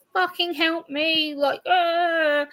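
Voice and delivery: imitating your own panicked venting; re-enacted panic rant. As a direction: act out a worked-up rant without raising volume. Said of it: "I will rant at people".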